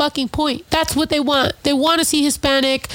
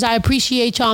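ordinary talk, nothing marked

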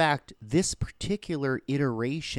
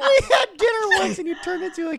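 laughing; 9 dB louder, faster than usual. Retold or imitated. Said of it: "mocking Barbara's friendship".

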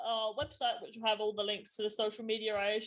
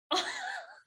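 high laugh. Real, not acted. Laughs at own joke.

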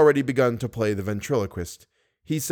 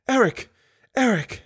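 high calling out, much higher; crying out; 11 semitones higher than usual. Acted. Christine calling out to stop Eric, high and urgent. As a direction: call his name out high and urgent.

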